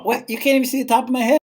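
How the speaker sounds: surprised rapid question; incredulous surprise. Real, not acted. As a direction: blurt it out, amazed at the bad framing. Reacts to framing of the shot.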